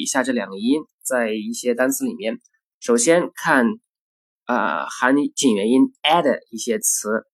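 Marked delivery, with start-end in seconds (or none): none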